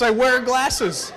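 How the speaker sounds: slow exasperated. Real, not acted. Exasperated confirmation.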